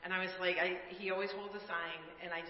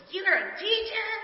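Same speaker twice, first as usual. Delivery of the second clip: much higher and louder, slow astonished question; disbelieving, pitched way up; surprised; 11 dB louder than usual. Retold or imitated. Man surprised she was teacher.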